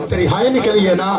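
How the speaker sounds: usual speaking voice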